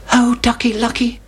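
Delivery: high anxious chick. A narrator's reading. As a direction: cry out like a worried chick. Chicken Licken alarmed.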